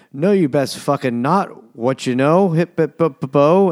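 high, loud comic challenge; mock defiance. Retelling Claire facing the English, playfully defiant.

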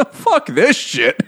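put-on high, slow drunken dismissal; imitated drunk. Drunk husband brushing off his mother-in-law, imitated slow slur. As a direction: drag it out like a mock drunk. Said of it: "like, drunk and he was like".

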